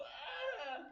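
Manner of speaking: scream. Retold or imitated. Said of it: "And I go".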